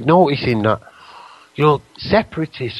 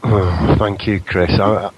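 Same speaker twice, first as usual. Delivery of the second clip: ordinary talk, nothing marked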